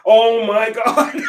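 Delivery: loud, high, drawn-out wail of panic; laughing mock-panic outcry; retold panic. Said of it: "I was in panic at that point".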